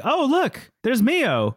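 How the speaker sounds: high, bright call of a name; a hint of a chirpy greeting; mock cheerful spotting. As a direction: pitch it way up like spotting a friend. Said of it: "it's like".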